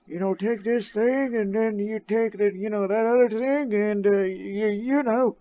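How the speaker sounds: put-on high, slow old man voice; quavering elderly physician. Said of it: "I do with my old man voice".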